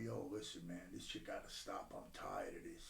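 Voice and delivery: worn out, barely getting it out; tired near-whisper; weary low murmur. Real, not acted. Despair before his breaking point.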